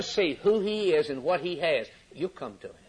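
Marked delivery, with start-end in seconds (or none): none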